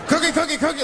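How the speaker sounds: a hammed-up greedy monster; Cookie Monster scream.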